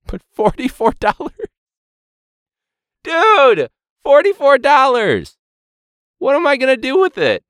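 high, loud disbelief at forty-four dollars; exasperated outburst. Real, not acted. Teacher ranting about the fundraiser, outraged high yell.

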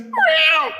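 very high, squealed mock roar; desperate cub growl. Cub's last stand against the wolf, desperate squeaky growl. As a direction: give a tiny bear's last-ditch roar. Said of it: "in one last moment of desperation".

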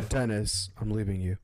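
low, mock soap-opera announcement; TV drama breakup. Friend parodying a TV breakup, low and dramatic. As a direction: play the departing partner in a TV drama. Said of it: "There's some drama television".

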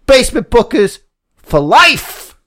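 light Scott Hall promo tag; Scott Hall impression. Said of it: "Scott Hall at the end".

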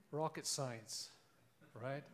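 normal talking voice

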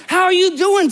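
bright, eager high hello; gushing greeting. Retold or imitated. Wife greeting the young couple, gushing. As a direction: greet them like it's the best day ever. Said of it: "I'm not exaggerating".

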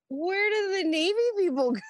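very high whine; lost sailor begging the petty officer; whiny plea. Retold or imitated. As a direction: ask it like a lost kid.

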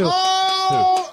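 high loud sudden oh; delighted shout of realization; excited oh. Real, not acted. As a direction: burst out with an eager oh. Excited realization before impression.

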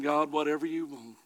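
low, soft mock-pious surrender; mock a meekly resigned believer, hushed and low; meek piety. Preacher mocking his own imagined surrender to the pit, meekly pious. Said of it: "with a little pious face".

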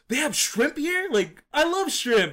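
high, excited imitated squeal of joy at shrimp; delighted surprise. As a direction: mimic his thrilled discovery, way up high. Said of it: "And he's like".

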